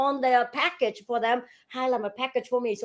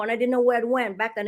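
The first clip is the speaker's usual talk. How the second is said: ordinary talk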